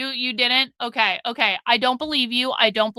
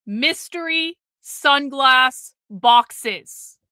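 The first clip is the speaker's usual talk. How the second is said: normal talking voice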